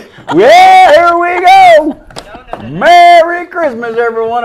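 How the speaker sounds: big high, drawn-out shout; festive holler. Real, not acted. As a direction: holler a slow Christmas greeting to the whole crowd. Calling out a festive holiday greeting.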